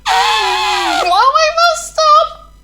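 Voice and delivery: very high, slow shout; panicked shout. Acted. Thomas shouting as he skids to the buffers.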